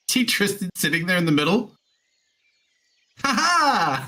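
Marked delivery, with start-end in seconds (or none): 3.2-4.1 high, gleeful "ha ha"; excited whoop. Acted. Player cheering as the stone clears, excited whoop. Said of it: "I love his excited whoop".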